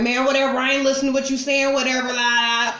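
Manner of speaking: singsong la la dismissal; dismissive mock voice. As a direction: mimic someone tuning you out. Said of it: "Or they just like".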